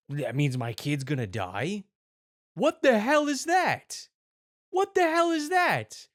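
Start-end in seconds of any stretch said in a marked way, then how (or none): 2.5-6.1 high, drawn-out exclamation of outrage; outraged disbelief. Real, not acted. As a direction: exclaim it high and stretched, appalled. Outraged reaction to the ad.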